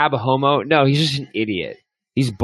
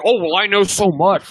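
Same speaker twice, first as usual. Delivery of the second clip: higher mock know-it-all; McNabb; 6 semitones higher, 4 dB louder than usual. Mocking a rival who talks constantly, higher know-it-all voice. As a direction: pitch it up, parody smug boasting. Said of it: "Every time he fucking opens his mouth".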